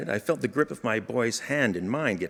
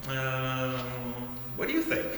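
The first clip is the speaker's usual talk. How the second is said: hesitant dodge; 9 dB quieter than usual. Retold or imitated. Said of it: "the wisest thing I could ever have said".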